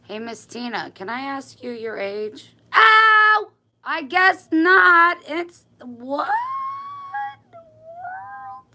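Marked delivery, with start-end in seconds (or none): 2.7-8.7 very high, loud yelp, then slow high whine; pained squeal. Real, not acted. Sewer pricked by her needle, yelping high.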